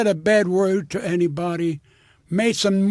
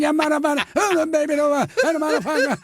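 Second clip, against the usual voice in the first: high, garbled imitation of a stage act; mock performer's babble; 7 semitones higher than usual.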